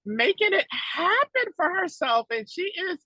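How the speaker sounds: high loud bragging about a friend; excited gush. Retold or imitated. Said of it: "I was like".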